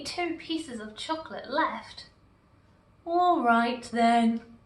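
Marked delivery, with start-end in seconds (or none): 3.0-4.6 slow reluctant agreement. A narrator's reading. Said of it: "he said".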